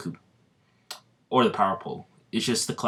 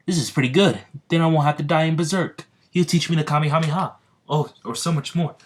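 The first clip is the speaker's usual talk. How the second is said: higher, gushing starstruck fan; excited fanboy; 5 semitones higher, 4 dB louder, faster than usual. Acted. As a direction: gush like a fan meeting his hero. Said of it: "he's being a little bit of a fanboy".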